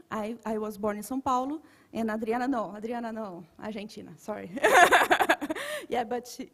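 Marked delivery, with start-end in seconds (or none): 4.5-5.8 loud burst of laughter; embarrassed laugh. Real, not acted. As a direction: laugh off the mix-up. Laughs after mixing up names.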